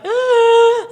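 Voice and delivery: loud, very high scream; reenacted fan shriek; excited squeal. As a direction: squeal open-mouthed with excitement. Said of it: "I was just so excited".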